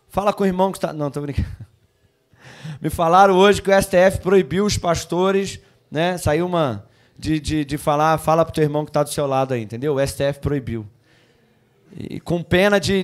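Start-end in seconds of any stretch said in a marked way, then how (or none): none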